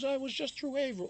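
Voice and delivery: lightly voiced friend, pitched high; friend's quote. Friend mentions a nearby town, lightly voiced and high. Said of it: "He goes".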